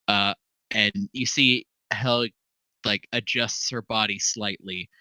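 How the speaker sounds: ordinary talk, nothing marked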